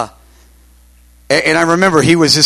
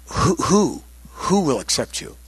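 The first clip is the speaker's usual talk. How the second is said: softly retold, halting on "who"; mildly puzzled, feel your way into the question; hesitant question; 6 dB quieter than usual. Asking the solemn visitor who will accept him, hesitant and soft.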